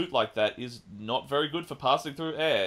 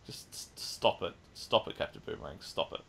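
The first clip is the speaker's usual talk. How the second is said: softer whispered stop it; whispered scold; 6 semitones lower, 6 dB quieter than usual. Real, not acted. Host muttering at Captain Boomerang, whispered scold. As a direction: hush him under your breath.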